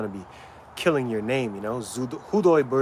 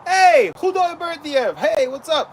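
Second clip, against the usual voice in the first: friendly greeting; 12 semitones higher, 4 dB louder than usual. Retold or imitated. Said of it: "they say".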